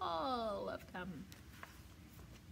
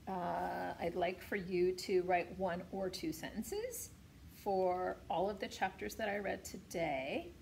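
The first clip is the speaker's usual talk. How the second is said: ordinary delivery, nothing marked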